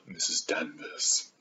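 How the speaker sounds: hushed, slow and sinister; ominous whisper. Pretend. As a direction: breathe the name like a secret. Ominous naming of housekeeper.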